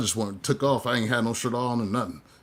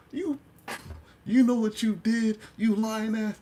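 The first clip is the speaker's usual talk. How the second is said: accusing voice; 11 semitones higher, slower than usual. Retold or imitated. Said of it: "all the filth alarms is coming out".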